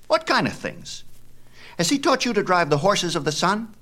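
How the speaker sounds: put-on high, quick bragging boy's voice; a show-off kid bragging about his dad; boastful boy Epaphus. A narrator's reading. Epaphus questions Phaethon.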